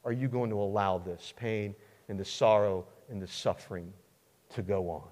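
word by word, sinking low and soft; pounding frustration. Acted. Suffering David questioning God, heavy frustrated plea. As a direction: beat out each word, then sink down. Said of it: "You can almost hear him pounding the table".

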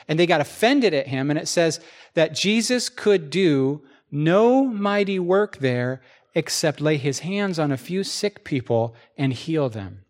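usual speaking voice

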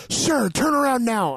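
high, slow, commanding cop voice; officer calling after him from behind, slow and commanding; stern cop command. Retold or imitated. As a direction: bark it like a cop ordering a stop. Cop ordering him to turn.

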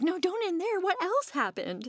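high, drawn-out mock plea; mock protest. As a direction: beg the movie not to stop. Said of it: "I'm like".

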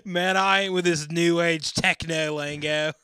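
loud, high, slow grumpy Aussie; Australian accent. Acted. Reading a grumpy answer in an Australian accent. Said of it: "in my Down Under accent".

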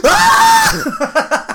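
very high comic scream; mock scream. Junior's imagined reply to scolding relatives, high mock scream. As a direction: let out a pretend shriek.

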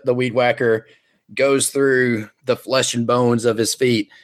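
ordinary talk

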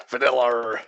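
"Vanilla" slowly, as va-nell-ARRR; imitated hard R. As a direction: overdo a drawn-out R on "Vanilla". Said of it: "it's harder here".

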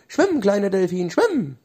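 high, rising cheer to the dolphin; fairy cheering the dolphin, high excited call. Acted. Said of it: "rief die Elfe".

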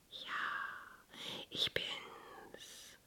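airy, very soft wind whisper; gusting windy whisper. Acted. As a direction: breathe it like the wind. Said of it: "flüsterte der Wind". (wehendes Windflüstern)

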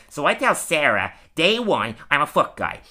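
badgering comic voice, climbing high on the repeats; imitating Wiggum. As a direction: play an insistent, chatty cartoon character. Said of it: "and Wiggum's like".

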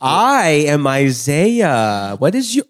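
very slow, drawn-out introduction; exaggerated careful slowness; talking to the elderly. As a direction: spell it out word by word, over-carefully. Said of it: "I've met elderly people as well".

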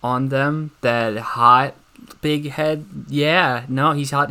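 ordinary talk, nothing marked

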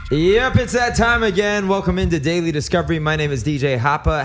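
bright, high show opener; radio host intro. Acted. DJ Harper opening the show for listeners, upbeat. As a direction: launch the segment with energy.

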